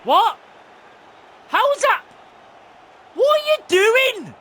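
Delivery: high, loud incredulous yelling; outraged disbelief. Real, not acted. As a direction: demand an explanation at full voice. Outraged at play on the pitch.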